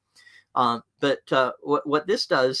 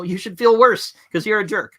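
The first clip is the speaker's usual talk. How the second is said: high, louder sarcastic jab; mocking retort; 8 semitones higher, 6 dB louder than usual. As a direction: sneer it back like a smug coworker. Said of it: "They'll just say".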